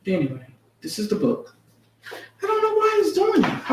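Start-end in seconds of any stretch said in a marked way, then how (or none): 2.1-3.7 pitched way up in frustration; a high, baffled repeat of the complaint; exasperated. Real, not acted. Vlogger baffled by her fading lighting, exasperated and high.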